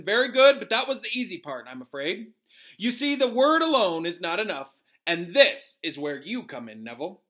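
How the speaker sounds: emphatic, animated professor; Professor Lupin teaching. Acted. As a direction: lecture the class with gusto.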